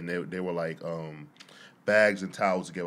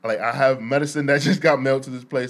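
mock pleading outburst, louder and laughing; going ham; 5 semitones higher, 9 dB louder than usual. Mentor demanding the mail center open, a loud half-laughing rant. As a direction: ham up a loud, desperate plea. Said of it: "he's just going ham".

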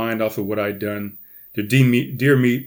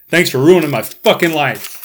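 louder mock yell, not full force; retold yell; 5 semitones higher, 7 dB louder than usual. Slamming the car door on his friend, a louder retold yell. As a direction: throw it back over your shoulder as a yell. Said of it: "yelled at the top of my lungs".

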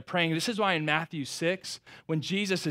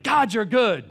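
louder, bursting praise to God; joyful outcry to God; 6 semitones higher, 9 dB louder, slower than usual. Retold or imitated. Preacher recalling his bursting heart, a joyful cry. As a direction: let the praise burst out of you. Overflowing joy praying over kids.

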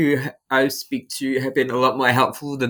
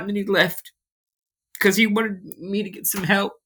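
crying; 7 semitones higher than usual. Real, not acted. To the interviewer, recounting a stranger's kindness, real tears. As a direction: voice pitched up and strained by real tears. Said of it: "And I'm sorry for crying".

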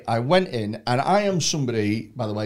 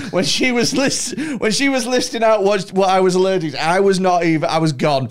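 laughing; 6 semitones higher, 6 dB louder than usual. Real, not acted. Admits he wasn't listening.

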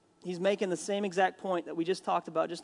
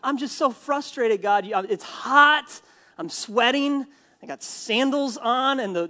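higher-pitched, exasperated complaint to God; frustrated rant; 4 semitones higher, 4 dB louder than usual. Acted. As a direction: act out Jonah fed up with the heat. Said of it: "he's just incredibly frustrated with God".

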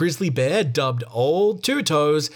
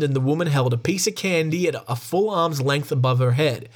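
ordinary talk

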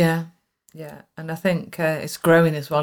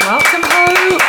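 high welcome; 13 semitones higher, 5 dB louder, slower than usual. Pretend. Hosts welcome guest in high voice.

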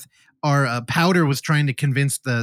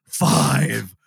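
imitated guttural possessed voice; guttural countdown; much slower than usual. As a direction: count down like a hammed-up demon. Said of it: "guttural voice".

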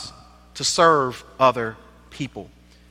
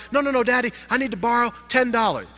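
put-on high, pleading child voice; eager little boy; 7 semitones higher, faster than usual. Little boy begging his dad to play, high and eager. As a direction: beg like a kid at the door.

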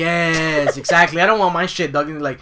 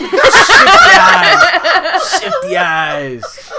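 slow words through loud laughter; laughing; 13 dB louder, much slower than usual. Real, not acted. Laughing at own joke.

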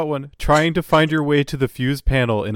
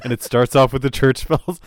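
ordinary talk

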